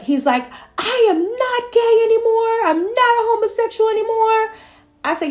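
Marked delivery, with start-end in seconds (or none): 0.8-4.5 put-on very high, bright-eyed boy's announcement; son's voice. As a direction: play the son proudly declaring. Son's proud announcement, imitated.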